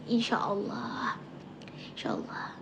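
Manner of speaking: hushed unvoiced Insya Allah; whisper. Real, not acted. Answering her sister's worry in a whisper. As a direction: murmur it like a small prayer. Quiet prayerful reply to sister.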